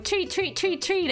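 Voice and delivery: cat button voice. Retold or imitated. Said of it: "was going".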